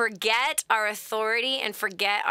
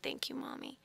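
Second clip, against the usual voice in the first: very hushed, near-whispered gratitude; soft child's thanks; 15 dB quieter than usual. Retold or imitated. Little son hugging his mother before sleep, very soft murmur.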